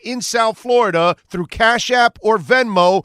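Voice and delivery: loud, punchy ad read; loud promo read. Acted. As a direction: sell it like a radio promo.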